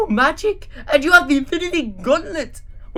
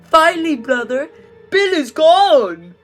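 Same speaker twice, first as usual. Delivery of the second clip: loud, higher, slow triumphant cry; joyful scream; 9 semitones higher, 8 dB louder than usual. Acted. Drew screaming with joy to his brother. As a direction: cheer with relief. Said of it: "screamed Drew".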